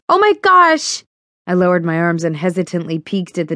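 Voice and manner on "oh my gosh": high, loud startled cry; shocked exclamation. Acted. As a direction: gasp it out in shock. Vials exploding, Fiona exclaims.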